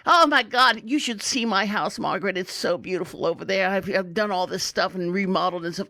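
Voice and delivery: lightly voiced old lady gossip; gabbing old lady. Old lady brags to Margaret about her house, lightly mimicked gabbing. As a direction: gush a little like an old lady. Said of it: "every old lady in there was screaming at everyone else".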